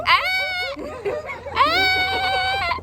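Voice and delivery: high, loud squeal of joy; happy graduation squeal; excited scream. Real, not acted. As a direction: squeal with delight. Celebrating graduation.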